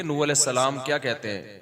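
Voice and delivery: ordinary delivery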